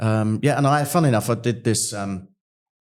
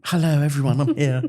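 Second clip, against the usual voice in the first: high, hammed-up hello to a crowd; playful drag-queen sing-out; showy greeting; 6 semitones higher, 4 dB louder than usual. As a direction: announce your own grand arrival. Acts out greeting town in drag.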